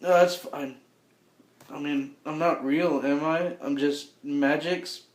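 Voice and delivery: soft, slightly halting, lightly voiced doppelganger; resigned doppelganger. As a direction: trail down into quiet resignation. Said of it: "and he goes".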